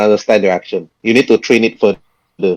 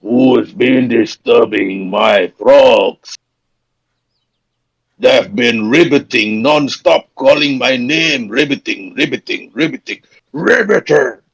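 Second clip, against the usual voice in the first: forest creature voice; 2 semitones higher than usual. Acted. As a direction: play an annoyed forest giant, stretching the words. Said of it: "you hear a voice that says".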